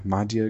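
"Magia" with a soft g, MAH-jee-a; soft G pronunciation. Shown as a demonstration. Unsure of a group's name, tries "Magia" with soft g. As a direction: say "Magia" with g as in "gem". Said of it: "is it the Magia".

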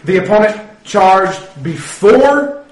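loud, slow referee ruling with long pauses; projected ref voice, dragged out and rising; referee announcement. Acted. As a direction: announce a ruling haltingly to the crowd. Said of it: "he turns on his little microphone".

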